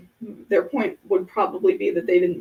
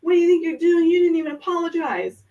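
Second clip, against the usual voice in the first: much higher and louder, hammed-up indignant yelling; mock angry outburst; 9 semitones higher, 5 dB louder than usual. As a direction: play the offended stranger snapping in outrage. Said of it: "they just come up to you and go".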